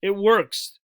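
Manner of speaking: usual speaking voice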